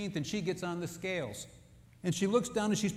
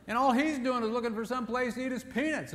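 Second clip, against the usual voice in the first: usual speaking voice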